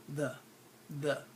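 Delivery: the said as a short THUH; soft, low, lazy thuh for the; lazy schwa. Shown as a demonstration. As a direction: relax the into thuh. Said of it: "it's kind of a lazy word".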